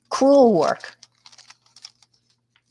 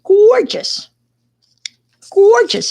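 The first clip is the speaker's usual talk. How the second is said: a big, loud, higher Long Island "Gorgeous", stretched out; Long Island accent; 13 semitones higher, 8 dB louder, slower than usual. Retold or imitated. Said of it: "as they would say on Long Island".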